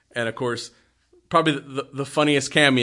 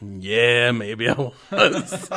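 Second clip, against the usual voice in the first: slow, sheepish Santa admitting it with a laugh; much slower than usual. Retold or imitated. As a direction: drag it out like a guilty Santa. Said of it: "He's like".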